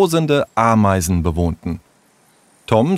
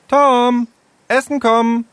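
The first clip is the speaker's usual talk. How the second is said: calling out. Acted. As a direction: call him in from far away. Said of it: "rief in diesem Moment eine Stimme".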